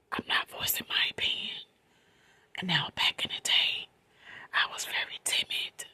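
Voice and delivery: soft late-night whisper; quiet at night. Real, not acted. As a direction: whisper so nobody wakes up. Late-night storytime, hushed.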